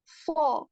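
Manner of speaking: सॉ as one heavy emphatic s, no w glide; heavy saad. Shown as a demonstration. Teacher warning students against swa, heavy saad. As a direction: say सॉ full and heavy, not स्वा. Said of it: "इसको स्वा नहीं बोलना है".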